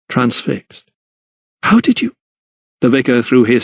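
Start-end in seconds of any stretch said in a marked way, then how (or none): 1.6-2.1 high, faltering disbelief; shocked, pitched-up fragment; stunned question. A narrator's reading. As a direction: ask as if caught out. Lydia transfixed, stunned.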